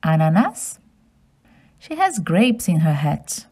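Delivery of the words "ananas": ananas as a-na-nas, rising; French pronunciation. Shown as a demonstration. Asking children to repeat ananas. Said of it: "Can you say ananas?".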